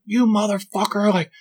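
hammed-up angry woman shouting at her man; imitating angry woman. Angry woman yelling at boyfriend.

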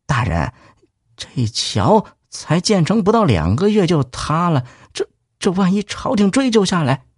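cautious. Acted. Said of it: "小心翼翼地说".

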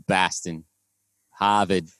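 slow, dropped-r Boston accent; lightly done Boston accent. Shown as a demonstration. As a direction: pahk the car in Hahvahd Yahd. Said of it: "¿Y el de Boston se te hace más fácil?".

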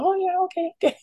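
high, eager little yes-voice; meek people pleaser. Retold or imitated. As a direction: agree instantly, high and pliant. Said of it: "So you go".

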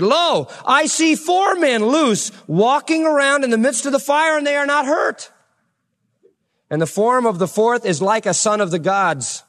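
high and slow, full of wonder; the king can't believe his eyes; amazed king. Acted. King sees fourth man in fire.

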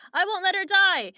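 high, loud refusal; defiant outcry. Acted. Retelling Jake refusing to abandon Cassie, defiant outcry. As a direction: cry out in mock-dramatic defiance. Acted quarrel over saving Cassie.

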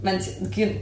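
ordinary talk, nothing marked

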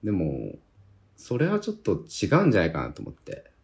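ordinary talk

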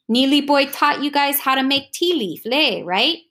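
lightly voiced Hawaiian uncle, cheerful; a hint of a bossy local uncle; Uncle Kahana voice. A narrator's reading.

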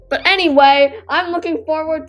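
loud reset. Real, not acted. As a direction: snap back on topic. Refocusing loudly.

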